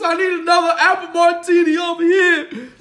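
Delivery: loud, high shout across the room; loud, high mock shout; shouted order. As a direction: holler for a refill like he owns the place. Shouting across the small restaurant.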